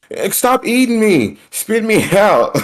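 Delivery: dad imitating his kids, high whiny child voice. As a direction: squeal it like a little kid being eaten in Yoshi. Parent voicing kids arguing in game.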